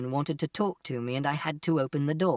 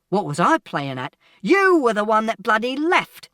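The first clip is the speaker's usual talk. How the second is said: loud retort building higher; angry shout; 6 semitones higher, 7 dB louder than usual. A narrator's reading. Father blaming mother for leaving, shouting angrily. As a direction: start loud, then rise to a furious yell. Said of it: "And Father shouted".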